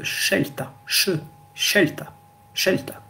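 scelta said shel-ta; Italian sce. Shown as a demonstration. Teacher modelling Italian sc sound, sh. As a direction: sc before e read as sh in scelta. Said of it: "on lira".

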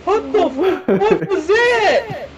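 startled laughing question; laughing off fear. Real, not acted. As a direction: laugh through the scare. Scared in a game, laughing.

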